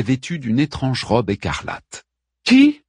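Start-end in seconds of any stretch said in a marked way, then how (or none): 2.4-2.8 high, loud one-word question; urgent demand. A narrator's reading. Queen asks dying woman who did this, urgent demand. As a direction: demand it sharply, pitched right up. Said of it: "demanda Pentésilée".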